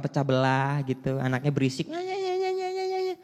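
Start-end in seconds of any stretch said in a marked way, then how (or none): 1.9-3.1 very high rapid ya ya; a fussing kid chanting; mock child noise; child's babble. Child clattering noisily.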